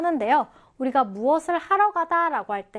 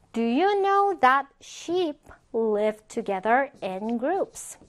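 slow, carefully spaced English example; slow model reading; much slower than usual. Shown as a demonstration. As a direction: read it slowly for learners to follow. Teacher models example sentence for learners.

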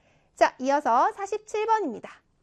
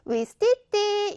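위스티띠 as if really said for a photo; 실제로 말하는 것처럼; 5 semitones higher, slower than usual. Shown as a demonstration. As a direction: say 위스티띠 lively, with a held last vowel. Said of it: "이렇게 읽어주자는 거죠". (실제로 사진 찍듯 "위스티띠")

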